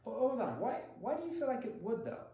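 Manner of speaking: mock-doubtful hold on; skepticism. Shown as a demonstration. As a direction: push back with curious disbelief. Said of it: "My skepticism?".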